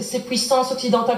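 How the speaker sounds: ordinary talk, nothing marked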